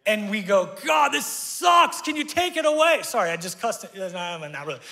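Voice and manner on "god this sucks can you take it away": mock grumbling prayer shouted up; whiny complaint. Whining prayer acted out.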